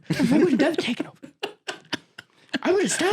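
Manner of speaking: high, fast [name] impression; [name] accent. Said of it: "every time I do that accent".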